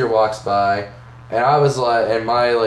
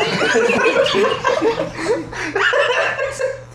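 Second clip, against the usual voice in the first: very high wheezing laugh; real high-pitched laughing fit; big laugh. Real, not acted. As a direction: burst out laughing, high and breathless. Laughter after punchline.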